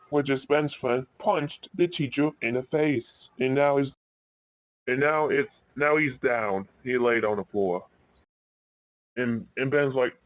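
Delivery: usual speaking voice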